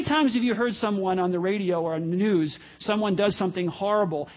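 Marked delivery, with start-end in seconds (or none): none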